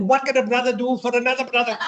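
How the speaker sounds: friendly airman. Retold or imitated. Airman's reply, high voice.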